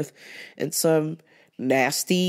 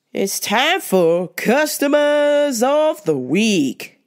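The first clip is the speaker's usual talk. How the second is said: announcer intro; 5 semitones higher, 4 dB louder, much slower than usual. Acted. Segment intro.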